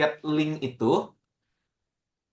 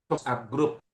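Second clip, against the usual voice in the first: ordinary talk, nothing marked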